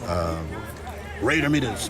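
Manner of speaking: ordinary delivery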